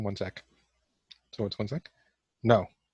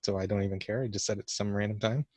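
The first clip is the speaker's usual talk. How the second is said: ordinary talk, nothing marked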